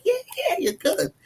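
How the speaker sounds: high-pitched playful take on an agreeing dad; imitated father. Father chiming in on praise for his daughter, playfully imitated.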